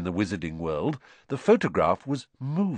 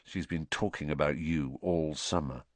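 low and much softer, aside; undertone; 5 semitones lower, 8 dB quieter than usual. A narrator's reading. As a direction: keep it just between you two. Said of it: "said Ron in an undertone".

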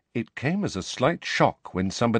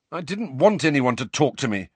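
higher, nettled retort; 6 semitones higher, 3 dB louder than usual. A narrator's reading. Harry answers Ginny back, nettled. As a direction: defend yourself, increasingly irritated. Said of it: "feeling more and more nettled".

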